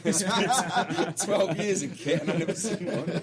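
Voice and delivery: slow, laughing deadpan. Real, not acted. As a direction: laugh through the punchline. Joke told laughing.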